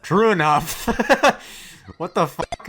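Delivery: loud, high, laughing disbelief; incredulous laughing. Real, not acted. Streamer reacting to awful parents, laughing in disbelief. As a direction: laugh out the words in shock.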